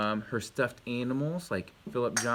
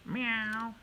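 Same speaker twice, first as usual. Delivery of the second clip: high, drawn imitation meow; cat meow; 11 semitones higher, 4 dB quieter than usual. Shown as a demonstration. Parent doing the phonics dance cat, high meow. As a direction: meow like a little cat, pitched up.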